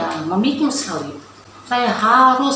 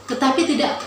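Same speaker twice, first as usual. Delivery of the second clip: ordinary talk, nothing marked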